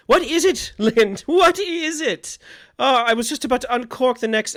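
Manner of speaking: high, loud bustling voice; excited old hostess. Acted. Franny interrupted while opening wine. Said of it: "Franny Beckett's voice say".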